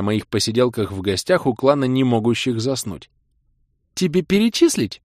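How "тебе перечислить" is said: put-on high, hurt girlfriend's retort; sulky young woman voice; offended. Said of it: "обиженно донеслось в ответ".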